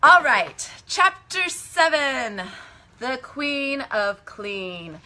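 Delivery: loud, high, drawn-out title announcer; hammed-up chapter announcement. Storyteller announces chapter seven to listeners, grandly and playfully.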